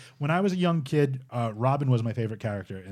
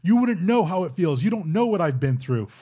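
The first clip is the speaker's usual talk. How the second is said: retold yell; 6 semitones higher, 5 dB louder than usual. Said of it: "He's yelling at Batman".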